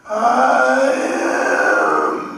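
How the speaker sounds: huge booming cave voice, slow; cave echo voice. Shown as a demonstration. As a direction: boom it out like a cavern. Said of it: "cave voice that was multiple sounds".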